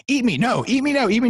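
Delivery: mock toddler whine, building; pestering two-year-old. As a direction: nag like a little kid who won't quit. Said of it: "like a two-year-old pestering you".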